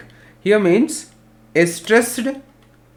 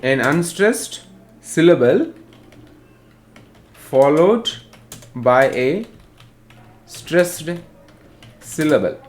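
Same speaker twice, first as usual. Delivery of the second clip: word-by-word classroom dictation; dictating. Real, not acted. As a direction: break it into pieces so students can write. Teacher dictates definition for note-taking.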